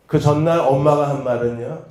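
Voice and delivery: normal talking voice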